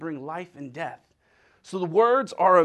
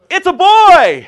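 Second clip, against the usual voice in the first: very high, shouted news; jubilant announcement; 13 semitones higher, 18 dB louder, much slower than usual. Acted. Comic announcing a birth, high jubilant shout.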